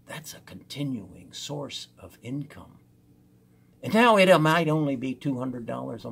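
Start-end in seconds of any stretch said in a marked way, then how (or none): 0.1-2.8 hushed whisper; whispering like a secret; whispered aside. Real, not acted. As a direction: lean in and whisper it.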